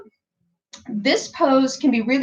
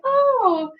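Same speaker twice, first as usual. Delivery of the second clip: high, mock-surprised oh; playful surprised oh; cute oh; 13 semitones higher than usual. Shown as a demonstration. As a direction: act pleasantly surprised. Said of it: "And it's like".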